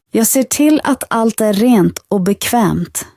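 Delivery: ordinary delivery, nothing marked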